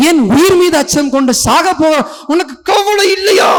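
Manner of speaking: high, frantic cry to rouse someone sleeping; panicked waking shout. Acted. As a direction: act out a terrified, shouted plea. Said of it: "அப்படித்தான் எழுப்பியிருப்பாங்க". (பதறி எழுப்பும் அலறல்)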